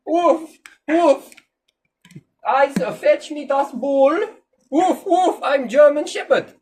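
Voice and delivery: put-on loud, high barking dog with German accent; German Shepherd dog. Dad plays a German Shepherd for daughter, loud high German dog voice. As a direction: bark out a comic German-speaking dog. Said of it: "can you do the impression of a German Shepherd".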